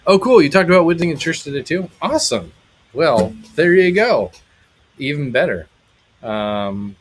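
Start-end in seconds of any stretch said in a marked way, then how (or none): 0.1-5.7 much higher and louder, real delight; light up at the good news; genuinely thrilled reaction to a viewer; excited. Real, not acted. Reacting to chat comment.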